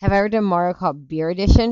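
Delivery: normal talking voice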